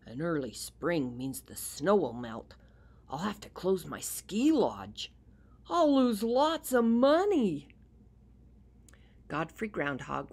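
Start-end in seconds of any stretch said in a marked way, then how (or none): 0.0-7.7 gruff harrumph. Acted. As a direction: grumble it, growing upset about money. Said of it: "he said to himself".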